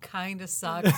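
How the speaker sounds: normal talking voice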